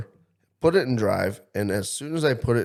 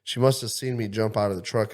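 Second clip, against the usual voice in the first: normal talking voice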